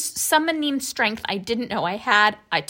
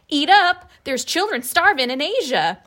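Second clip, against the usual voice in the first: louder, higher Southern grandma ordering him to eat; Southern drawl; 6 semitones higher, 5 dB louder than usual. Retold or imitated. Grandma insisting the boy finish, loud Southern drawl. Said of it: "Mama Roo said in her southern drawl".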